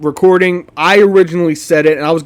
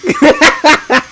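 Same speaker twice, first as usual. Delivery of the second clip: laughing; 8 semitones higher, 3 dB louder than usual. Real, not acted. Laughing at misheard lyric.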